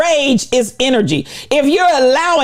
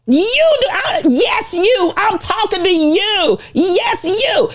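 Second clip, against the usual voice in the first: calling out; 8 semitones higher, slower than usual. Real, not acted. Pointing at listener.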